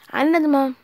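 yelling back at mom; loud sharp voice. Pretend. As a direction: shout it back, annoyed.

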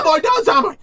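sudden high shout. Real, not acted. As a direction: yell at the screen. Shouting at game character.

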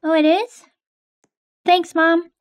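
ordinary delivery

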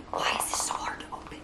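fast annoyed whisper; whispered frustration. Real, not acted. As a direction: whisper it, fed up. Struggling to open bronzer.

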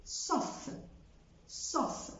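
sauf ending in f; final f sounded. Shown as a demonstration. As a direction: keep the f. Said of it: "مش بقول". (sauf بـ f)

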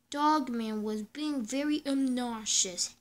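ordinary delivery

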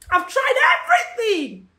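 high, strained wail of frustration; acted despairing outburst; desperate cry. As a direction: cry it out at the end of your strength. Acts out a desperate believer.

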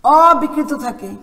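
slow dictation with long pauses between phrases; classroom dictation. Real, not acted. Teacher giving pupils a rule to write down, slow dictation. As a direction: measure out each phrase so it can be written down.

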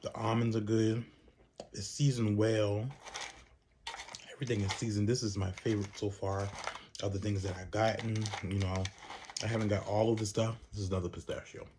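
talking between bites; chewing while talking. Real, not acted. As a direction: mouth partly full. Seen 2: Eating from snack bag, jaw moving while talking, looking down.

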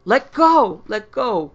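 very loud, high screaming shout; desperate loud yell; terrified shout. Acted. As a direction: scream it as if seized by something.

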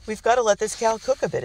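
light amused reassurance; laughing. Acted. Father laughs with his son by the grill, amused. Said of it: "his father laughed".

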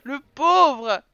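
loud, drawn-out exclamation; amused pity. Real, not acted. Player pitying Dan left at the mirror all night, amused wail. Laughs over forgotten Sim Dan.

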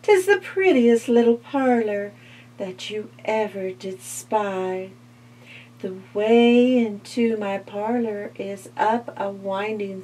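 sly spider. Acted. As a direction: invite her in, sweet and sly. Spider tempts the fly.

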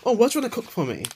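a hint of a doting date, quick and high; mock sweet suitor. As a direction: lightly send up the eager boyfriend. Mocking guys who want domesticity.

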